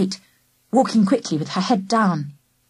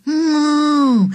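higher, louder drawn-out moo; cow moo; 7 semitones higher, 4 dB louder than usual. A narrator's reading. A heifer moos at the children, a loud moo. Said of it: "One of the heifers said".

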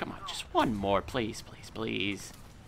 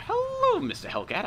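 high squealed greeting; 6 dB louder than usual. Acted. Squealed taunt to enemy tank.

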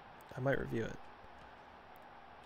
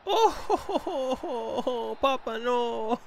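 loud, high, drawn-out whoop; laughing shout; 10 semitones higher, 6 dB louder, much slower than usual. Real, not acted. Gamer reacting to Papa in a match, laughing loud whoop.

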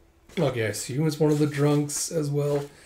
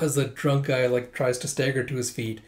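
ordinary delivery

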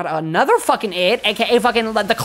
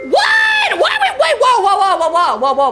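much higher, loud shocked shout; 10 semitones higher, 3 dB louder than usual. Real, not acted. Streamer hearing the game wants hide and seek, shocked shout. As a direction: yell it in disbelief.

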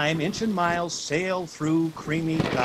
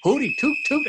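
whistle imitation; 11 semitones higher, 5 dB louder, faster than usual. A narrator's reading. Blows on the whistle.

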